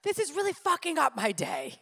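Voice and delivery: said out loud. Retold or imitated. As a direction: blurt it out in frustration. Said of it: "I said out loud".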